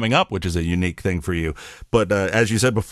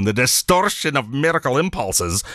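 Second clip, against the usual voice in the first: playful Scottish brogue; Scotty; 3 semitones higher than usual. Acted. Host recalling his study group gag, Scottish accent. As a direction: roll it out in a mock Scottish accent. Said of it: "becomes nicknamed Scotty".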